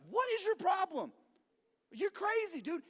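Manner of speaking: high-pitched incredulous shout; indignant yell, played up; mock outrage. Youth dodging a leader's spear, high shouted disbelief. Imagined reply to thrown spear.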